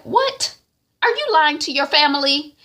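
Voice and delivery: very high shocked what; outraged surprise. Retold or imitated. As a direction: catch her in a lie. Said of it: "wifey is like".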